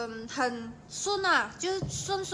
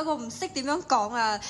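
ordinary talk

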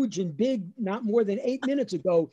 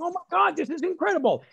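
put-on much higher, excited kid; thrilled disbelief; 10 semitones higher, faster than usual. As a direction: gush in high-pitched amazement. Child thrilled at leading the singing.

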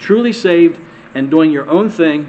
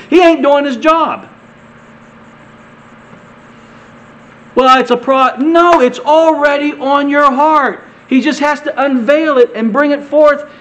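raised, high-pitched pulpit voice; impassioned preaching; 7 semitones higher than usual. Real, not acted. Preacher urging congregation to trust the Spirit within, impassioned preaching. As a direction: drive each point home with fervour.

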